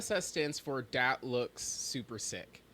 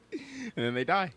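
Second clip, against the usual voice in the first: laughing; 7 semitones higher than usual. Real, not acted. Laughing mock tragic.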